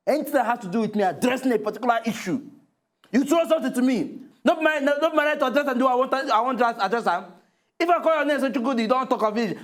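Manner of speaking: high, agitated protest; vexed, shaking. Acted. Co-host protests at being picked on, vexed and agitated. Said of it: "You dey vex, you dey shake".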